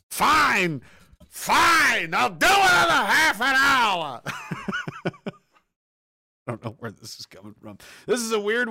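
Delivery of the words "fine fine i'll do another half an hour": loud high fed-up yell; mock exasperated shout. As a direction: bellow it in mock frustration. Exasperated over time left.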